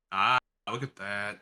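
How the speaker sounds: ordinary delivery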